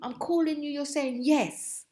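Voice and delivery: imitating mum calling her rude; scolding mother. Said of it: "'Cause she said".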